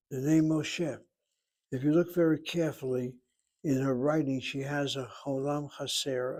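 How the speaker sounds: ordinary delivery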